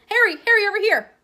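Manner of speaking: high, loud excited hail; calling out. Acted. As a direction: hail a friend from afar. Girl calls from the steps.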